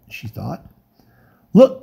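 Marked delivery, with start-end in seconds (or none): 1.5-1.8 hoarse, urgent cry. Acted. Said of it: "cried Rhinelef's voice hoarsely".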